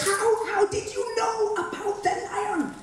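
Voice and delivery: astonished question. Acted. Hunter asks the blind man how he knew, astonished. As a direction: ask it in wide-eyed disbelief.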